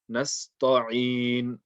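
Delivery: "nasta'in" with the ta said heavy. Shown as a demonstration. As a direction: make the ta of "nasta'in" thick and overemphasized, as a mistake. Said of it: "made it heavy".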